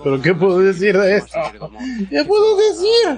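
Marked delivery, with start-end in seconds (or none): 2.1-3.2 very high, excited wail; overwhelmed squeal. Real, not acted. Fan at a loss for words after reveal, high overwhelmed squeal. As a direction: wail it helplessly, pitched way up.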